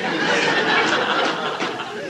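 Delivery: a high breathy burst of laughter; airy laugh. Real, not acted. Director recalling Meryl's fall, breathy laugh.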